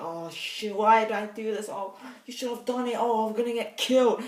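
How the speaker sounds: high, mock-panicked muttering to himself; panicked hero talking to himself. Reviewer imitating the old McClane talking to himself, in a high mock-panic. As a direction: play the hero freaking out in his head, pitched well up. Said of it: "he would talk to himself".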